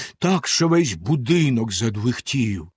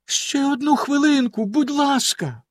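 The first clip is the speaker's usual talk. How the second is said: much higher, begging; pleading; 13 semitones higher than usual. A narrator's reading. Wife begs Bluebeard for a minute, high pleading. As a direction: plead for one more minute. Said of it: "попросила його дружина".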